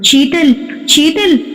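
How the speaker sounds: high, slow frightened calls of the name; panicked calling out. Acted. Varma, scared by a shadow, calling Sheetal in slow high panic. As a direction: call her name in panic, pitched high and drawn out. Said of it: "భయంతోనే కంగారు పడిపోతూ".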